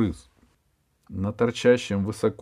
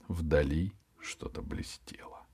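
whispering; 3 semitones lower, 9 dB quieter than usual. Acted. Narration drops to whisper.

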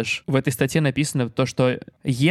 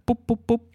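high quick "pup-pup-pup" pops; bubble wrap popping; 10 semitones higher than usual. Retold or imitated. (имитация лопающейся плёнки)

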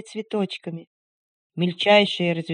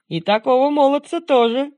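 lightly voiced young man; 6 semitones higher, 6 dB louder than usual. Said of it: "сказал молодой человек".

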